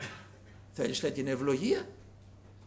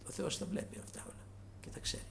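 whispered warning; 3 semitones higher, 11 dB quieter than usual. Real, not acted. Preacher's hushed warning.

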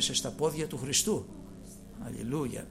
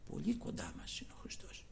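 whisper; 13 dB quieter than usual. Real, not acted. Preacher whispers intimately.

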